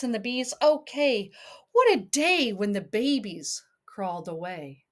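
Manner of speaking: lilting read-aloud storybook voice; sing-song picture book reading; reading to a child. Acted.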